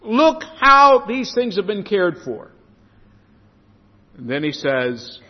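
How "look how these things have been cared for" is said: marvelling exclamation, higher and louder, slowed; amazed nations. Retold or imitated. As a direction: proclaim it in wonder like onlookers seeing a miracle. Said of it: "Nations will look on and say".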